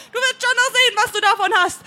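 high, loud threat; angry call. A narrator's reading. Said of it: "schaut er doch böse und ruft". (böse gerufen)

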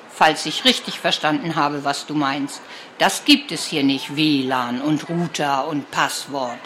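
a dry grandma who wants none of it. Acted.

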